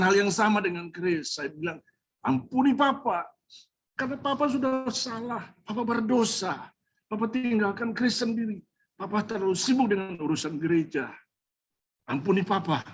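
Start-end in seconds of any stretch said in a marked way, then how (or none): 2.2-12.8 higher, begging for forgiveness, then easing back down; a father asking his son to forgive him, tearfully; tearful plea. Retold or imitated. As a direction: plead with a son, near tears at first. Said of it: "Kami berdua menangis".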